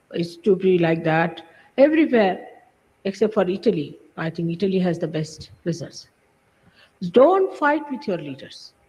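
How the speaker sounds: usual speaking voice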